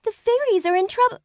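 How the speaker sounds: high, fast fairy voice in alarm; urgent fairy. Acted. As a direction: rush out the warning. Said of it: "Rainbow Frost cried".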